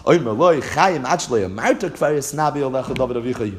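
normal talking voice

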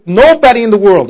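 emphatic "nobody", the rest of the phrase trailing lighter; stress on nobody. Shown as a demonstration. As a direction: lean hard on "nobody" to make the point. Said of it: "I emphasize the words nobody".

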